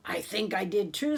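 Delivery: usual speaking voice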